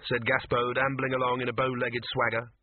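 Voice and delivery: ordinary delivery, nothing marked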